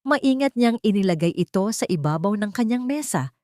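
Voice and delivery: plain reading voice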